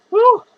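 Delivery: high, loud whoop. Real, not acted. Drunk vlogger whooping to viewers, a loud high "woo". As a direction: whoop it up loudly.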